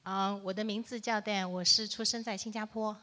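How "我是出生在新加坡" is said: ordinary talk, nothing marked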